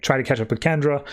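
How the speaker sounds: ordinary delivery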